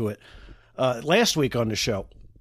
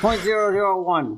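higher, dim-witted caller voice; put-on dopey voice; 6 semitones higher than usual. Pranking the mortgage caller in a higher, dopey voice. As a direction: play dumb for the telemarketer.

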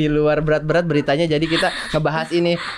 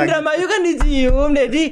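laughing; 9 semitones higher, 4 dB louder than usual. Real, not acted. Joking amid laughter.

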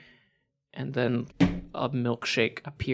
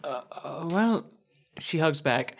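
usual speaking voice

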